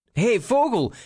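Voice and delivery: high, indignant storybook shout; angry call. A narrator's reading. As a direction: call out crossly to a thief, pitched high. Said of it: "riep Eduard boos". (boos en hoog geroepen)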